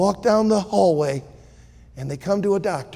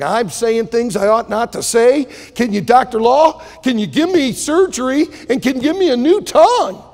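pleading; 5 semitones higher, 6 dB louder than usual. Acted. Lester begs for new tongue.

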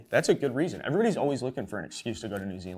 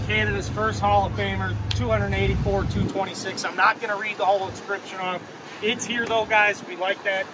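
a higher, eager on-location reporter voice; field reporter; 10 semitones higher, 6 dB louder than usual. Acted. Host reviewing the new statue at Wrigley, as a reporter. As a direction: sell the statue like a TV correspondent.

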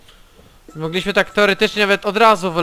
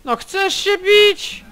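mocking challenge; 11 semitones higher, slower than usual. Real, not acted. Taunting an enemy in the fight.

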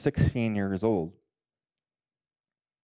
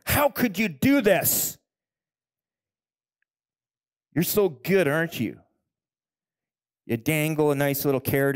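loud, high bitter reproach; sarcastic, furious retold complaint to God; angry outcry at God; 6 semitones higher, 6 dB louder than usual. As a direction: shake your fist at heaven and accuse. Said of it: "shaking my fist at God".

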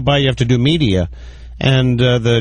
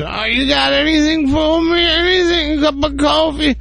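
much higher, whiny begging voice; pleading beggar. Retold or imitated. The beggar asking her for money, high whiny pleading. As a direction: beg pitifully for anything at all.

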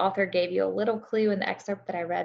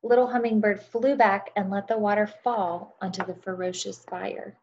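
storytelling narration. A narrator's reading. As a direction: tell it like a bedtime story to a class. Reads story aloud to children.

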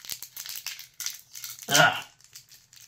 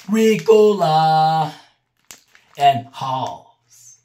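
Ricola ad call; 5 dB louder, much slower than usual. Pretend. Naming cough drop brand, ad-style.

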